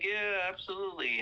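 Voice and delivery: high, eager agreement; happy. Retold or imitated. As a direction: imitate an excited kid saying yes. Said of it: "so happy to see Santa".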